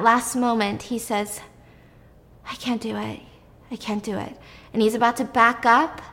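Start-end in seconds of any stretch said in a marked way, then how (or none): 2.5-4.4 soft, defeated retold admission; quiet surrender. Host voices a father unable to inject her, quiet surrender. Character gives up at last moment.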